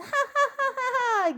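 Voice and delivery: high ha-ha laugh. Acted. Joker after the fans punchline, high laugh. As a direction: laugh brightly at your own gag. Laughs at own fan joke.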